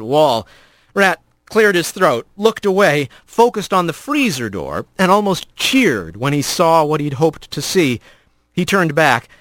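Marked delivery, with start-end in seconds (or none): none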